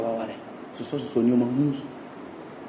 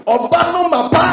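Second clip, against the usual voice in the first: high, louder cry on "Oba na ma pa"; excited exclamation; 12 semitones higher, 4 dB louder than usual. Real, not acted. Preacher heightening a repeated phrase for listeners, excited exclamation. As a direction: lift "Oba na ma pa" right up.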